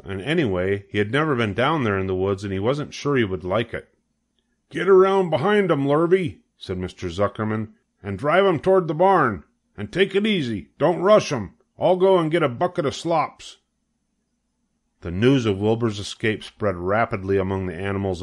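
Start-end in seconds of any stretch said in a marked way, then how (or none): 4.7-6.4 high, loud instruction; farmer giving orders. A narrator's reading. Farmer directing hired man to catch pig, raised voice. 8.0-13.6 farmer giving orders. A narrator's reading. Farmer directing hired man to catch pig, raised voice.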